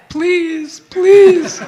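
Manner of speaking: loud, high begging cry, hammed-up; comic over-the-top plea; desperate pleading. Acts out begging for keyboardist's return.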